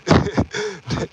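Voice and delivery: high wheezy chuckle; real laugh bursting out; amused laugh. Real, not acted. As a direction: laugh at the mishap. Amused at what just happened.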